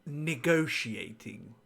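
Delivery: slow sarcastic "Negotiating" drawn out as if in air quotes. Pretend. Mocking the captive's word "Negotiating", slow and sarcastic. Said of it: "do the air quotes thing".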